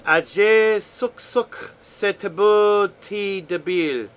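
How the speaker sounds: slow, loud, careful reading of foreign words. Shown as a demonstration. Reading a friend's native language aloud, slow and loud. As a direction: sound out each foreign word, slowly and loud. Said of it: "I'll do my best to, to not massacre it when I'm reading it back".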